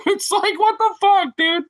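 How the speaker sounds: high, squeaky disbelief; can't believe this, squeaking; incredulous. Real, not acted. Mock outrage at the movie.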